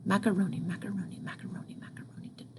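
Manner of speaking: even macaroni, fading from soft into a whisper. Shown as a demonstration. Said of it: "It's nice and even".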